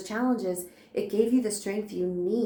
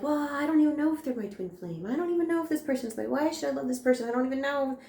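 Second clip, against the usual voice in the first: higher, faster mock voice of an unsure seeker; doubtful seeker; 6 semitones higher, faster than usual. Reader mimics viewers doubting their twin flame, in a higher, quick mock voice. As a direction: rattle off their doubts in a higher pitch, imitating them. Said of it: "Some of you are like".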